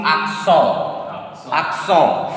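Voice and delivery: "accent" as ak-SON, nasal ending and silent t; French pronunciation. Shown as a demonstration. Teacher sums up the silent t rule, French "accent". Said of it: "So we are pronouncing".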